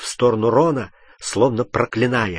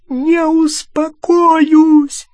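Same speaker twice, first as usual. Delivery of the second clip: very high, loud, drawn-out screech; furious shriek; 8 dB louder, much slower than usual. A narrator's reading. Hermione refusing Harry's order to calm down, shrieking. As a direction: screech it, stretching the word. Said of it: "завизжала она".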